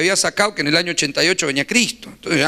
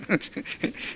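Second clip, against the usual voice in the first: breathy silent laugh; 3 semitones higher, 11 dB quieter than usual. Real, not acted. Laughs at his own story.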